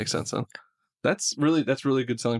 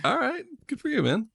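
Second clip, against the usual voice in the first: high mock praise. Mock impressed reaction.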